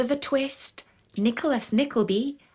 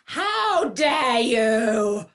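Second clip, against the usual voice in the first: slow, loud furious roar; 3 semitones higher, 6 dB louder, much slower than usual. Acted. Lioness queen roars at the mouse on her nose, outraged. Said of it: "I roared".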